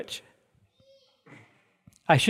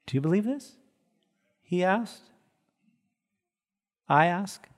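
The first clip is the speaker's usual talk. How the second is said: ordinary delivery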